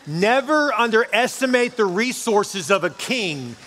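high, loud, slow preaching swell; preaching at full force. Acted. Pastor proclaiming the King of Kings to the church, in a slow, soaring preach. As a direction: stretch each phrase out and build. Preaching climax on God's riches.

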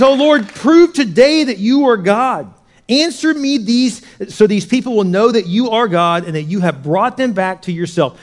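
high, loud, stretched-out proclamation; confident prayer. Acted. Elijah prays for fire at the altar, confident. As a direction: cry it boldly to God at full voice. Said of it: "confidence of Elijah".

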